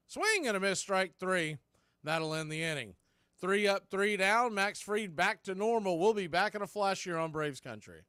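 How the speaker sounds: rising play-by-play excitement; excited strikeout call. Real, not acted. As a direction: call the final out with a lift. Strikeout call ending the inning.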